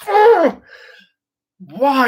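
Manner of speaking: high, laughing cry at the universe; mock exasperation. Actor moaning at fate in a high laughing squeal. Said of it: "I was like".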